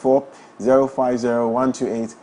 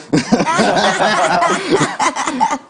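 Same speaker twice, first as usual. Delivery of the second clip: high, loud peal of laughter; hearty laugh; 9 semitones higher, 5 dB louder than usual. Real, not acted. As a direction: burst out laughing at the verdict. Host laughs at posture verdict.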